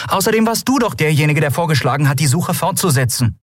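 high, quick accusation; quarrelling retort. A narrator's reading. Captain arguing with her engineer, heated high voice. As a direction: throw it back at him, pitched high. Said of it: "sich anschrien".

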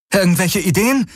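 high, urgent called question; rufen. A narrator's reading. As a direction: call the question out, pitched high. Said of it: "rief Kirai". (hoch gerufene Frage)

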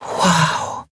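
whispered wow of amazement; breathed wow. A narrator's reading. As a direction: breathe it out in awe. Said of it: "hauchten Nelen und Miko unisono".

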